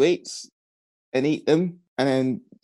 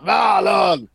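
put-on menacing bully kid shout; deep voiced child; 7 semitones higher, much slower than usual. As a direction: call out the threat like a bully kid. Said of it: "and a deep voice".

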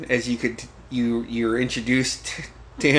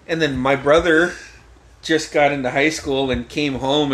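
ordinary talk

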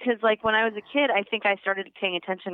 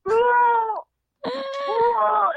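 high, loud retching howl; mock throwing-up wail, pitched high; hurling noise; 13 semitones higher, 3 dB louder than usual. Shown as a demonstration. Caller demonstrates new Klingon over the phone, high hurling noise. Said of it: "It's like a hurling noise".

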